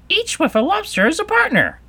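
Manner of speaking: high, loud creature cry; Griffin crying out. A narrator's reading. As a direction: call it out way up high. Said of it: "cried the Griffin".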